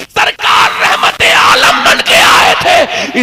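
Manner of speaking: high, ringing preacher's call; fiery preaching. Acted. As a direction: build the sermon to a soaring peak. Preaching surge again.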